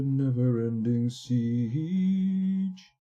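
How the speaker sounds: very slow, dramatic trailer voice; dramatic announcer. Pretend. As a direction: stretch it out like a movie trailer.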